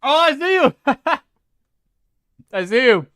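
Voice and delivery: a high, loud delighted call; excited shout. Real, not acted. Streamer spotting the viewer's name, shouting happily. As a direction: shout it out, thrilled to spot them.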